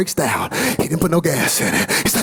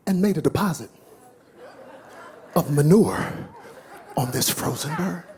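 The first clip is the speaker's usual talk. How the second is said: low, unhurried parable telling; story narration; 4 semitones lower than usual. A narrator's reading. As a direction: draw them into the tale. Tells the parable slowly.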